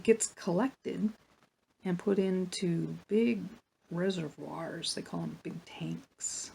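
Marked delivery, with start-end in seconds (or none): 5.0-6.5 whisper. Real, not acted. Aside while explaining.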